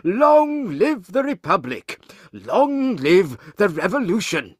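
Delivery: high, loud, drawn-out rallying cry; storybook crowd's triumphant shout; revolutionary cheer. Acted. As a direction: proclaim it slowly to a roaring crowd. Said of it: "they shout".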